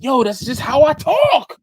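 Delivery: loud, high mock yell; exasperated shout. Defending his speech to coworker, loud exasperated shout.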